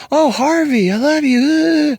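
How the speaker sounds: very high, slow swooning adoration; mock Oprah gushing. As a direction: coo it like an adoring talk-show host. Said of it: "You can watch Oprah".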